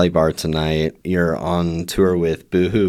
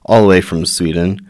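loud, upbeat host welcome, a bit fast; bright announcer-style introduction of the guest; show host intro; 8 dB louder than usual. Acted. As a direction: open the show like a radio host.

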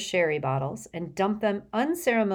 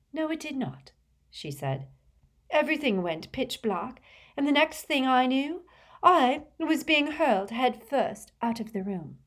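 high, dramatic retelling; indignant theatrical seer; 9 semitones higher than usual. Acted. Trelawney telling Harry how she was thrown out, indignant. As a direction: recount it with wounded drama.

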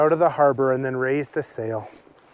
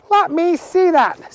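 put-on much higher, slow demanding girl voice; insistent Agnes; 13 semitones higher, 3 dB louder than usual. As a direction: demand to see it.

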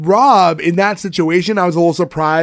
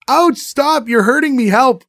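fake kid's cry for help; 8 semitones higher, slower than usual. Kid fakes being hurt.

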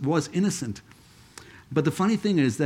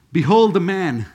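"Behold the man" called out loudly; ringing, raised-voice declaration; loud proclamation; 3 semitones higher, 7 dB louder than usual. Acted. Same line repeated as Pilate proclaiming.

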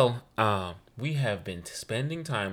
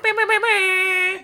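squeaky high air horn noise; helium voice air horn; 7 dB louder than usual. Retold or imitated. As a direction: mimic an air horn in a tiny voice. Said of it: "cutest little helium voice".

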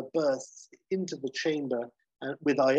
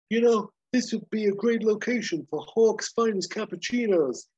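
high, loud smug hero voice; smug salesman for his coffee; Hawk's pitch; 8 semitones higher, 5 dB louder than usual. Retold or imitated. Hawk pitching cappuccinos to friends, smug and raised. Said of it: "and Hawk saying".

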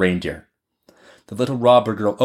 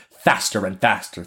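ordinary delivery

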